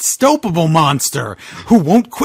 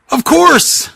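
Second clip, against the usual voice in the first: high, excited cry of discovery; sudden realization; 11 semitones higher than usual. Acted. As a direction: it just clicked for him. Boogeyman suddenly realizes the answer.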